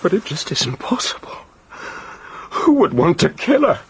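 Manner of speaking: whispered, shocked disbelief; stunned disbelief. Acted. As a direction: whisper it as if you can't take it in. Disbelief at finding murder victim.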